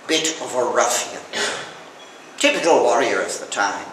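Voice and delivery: talking with slipping false teeth; words slurred by loose dentures. Real, not acted. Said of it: "my false teeth are slipping up and down".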